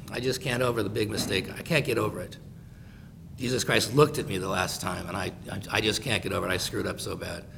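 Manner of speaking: low, very soft, defeated voice; mock self-pity. Imagined Peter can't get over his mistake, acted despondent. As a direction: wallow in guilt, low and quiet.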